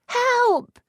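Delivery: very high, loud shout; high scream for help. Acted. Lisette screaming for rescue, very high and loud. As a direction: scream it through the door. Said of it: "Elf screamed".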